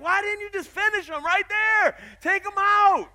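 high, loud mock outrage at the movie heroine; shouting at a horror movie character to finish him; exasperated yelling at the screen. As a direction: yell at the girl on screen. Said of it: "I'm like".

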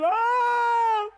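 long, high, loud mock scream; imitated screaming cry; imitated child's scream. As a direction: wail like a terrified little boy. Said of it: "Thaddeus started screaming, crying".

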